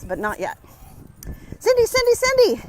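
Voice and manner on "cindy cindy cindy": high, loud call across the street. Real, not acted. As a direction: call out to a friend. Calling out to a friend.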